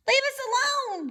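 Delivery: very high squeaky weasel cry; squeak. A narrator's reading. Weasel squeaks at the robot, very high. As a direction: squeak in fright. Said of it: "squeaked Dart".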